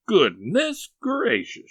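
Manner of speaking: high, loud and slow goodness gracious; old aunt's loud fretful cry; alarmed exclamation. Acted. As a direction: gasp it out in dismay. Sally alarmed.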